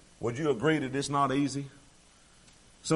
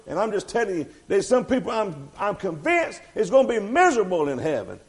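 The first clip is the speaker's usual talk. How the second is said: loud, higher fervent preaching; preaching at full force; 7 semitones higher, 9 dB louder than usual. Acted. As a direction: drive it home to the congregation.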